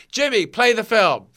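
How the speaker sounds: loud, high call down the phone; calling the projectionist. Retold or imitated. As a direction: order the projectionist to roll the film. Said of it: "they'd say".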